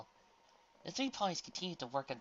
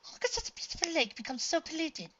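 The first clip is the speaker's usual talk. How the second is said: put-on much higher, girlish filly voice; 4 dB louder than usual. A narrator's reading. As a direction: lift into a young filly's voice. High filly voice, far.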